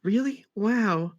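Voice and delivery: put-on high, amazed girl voice; high surprised girl. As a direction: squeak out her surprise. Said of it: "said Anne Frank".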